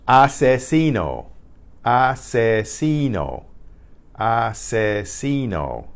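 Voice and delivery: asesino slowly as ah-seh-SEE-no; slow, careful teacher's model of asesino; Spanish pronunciation demo. Shown as a demonstration. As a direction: dictate asesino with drawn-out syllables and stressed SEE. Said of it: "write that from hearing it".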